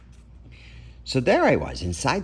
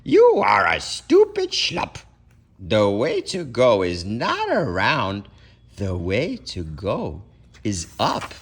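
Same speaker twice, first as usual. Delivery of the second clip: up hunch; 5 dB louder than usual. Acted. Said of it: "an up hunch laugh".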